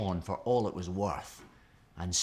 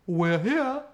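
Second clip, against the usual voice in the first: a louder, higher storybook giant announcing arrival; gentle giant; 10 semitones higher, 8 dB louder than usual. A narrator's reading. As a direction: giant welcoming the visitor. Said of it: "said the gentle giant".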